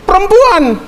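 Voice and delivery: high, put-on blurt; Adam blaming. Adam blaming the woman before God, high-voiced. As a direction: throw the blame in a high voice.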